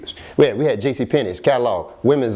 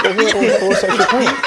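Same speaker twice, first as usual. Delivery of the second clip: egging on; 8 semitones higher, 4 dB louder than usual. Retold or imitated. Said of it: "I'm like".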